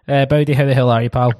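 normal talking voice

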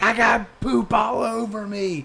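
high, slow mock-kid wail; imitating upset child. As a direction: wail it like a horrified little boy. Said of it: "And he's like".

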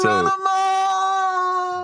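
high, long-drawn hammed-up yell; mock battle cry. Host imagines the Texan riding the bomb down, hammed-up yell. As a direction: stretch it out like a cowboy riding a bomb down.

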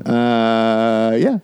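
long drawn-out uh before a small yeah; suspicious hesitation. Real, not acted. Man pressed on whether he can drive, suspiciously hesitant. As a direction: stall on the uh, then agree unconvincingly. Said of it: "you sound so suspicious".

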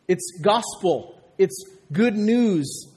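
slow, weighty, each word landed; slow emphasis. Real, not acted. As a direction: spell out "good news" slowly for the congregation. Preacher defines gospel slowly.

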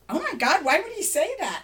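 high, loud, aghast reaction; retold shock. Mother hearing what her son said, high retold shock. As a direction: re-enact being appalled, pitch shooting up.

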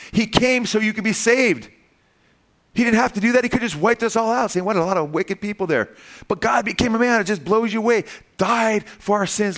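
high, ringing gospel proclamation; impassioned preaching. Real, not acted. Preacher tells congregation why Christ came, impassioned preaching.

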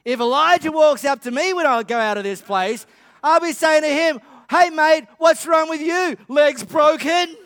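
hammed-up scolding of a lazy man; indignant retort. Women in the congregation tell Elijah off, loud and mocking. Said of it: "you ladies are thinking".

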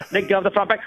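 usual speaking voice

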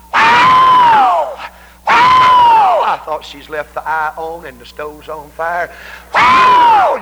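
very high shouted "Wow!"; imitated shout. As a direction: whoop like a woman in holy joy. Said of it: "you'd hear something".

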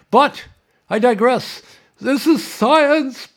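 high, loud and slow, hammed-up showman cry; mock grand proclamation. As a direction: belt it out like a hammy TV science host. Mock-grand sign-off catchphrase.

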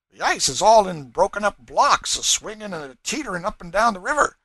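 put-on high, loud amazed old servant; Cudjoe. A narrator's reading. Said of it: "said Cudjoe".

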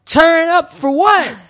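high, drawn-out hype yell; mock party shout. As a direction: imitate her party chant, loud and slow. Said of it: "Bree walks around the house going".

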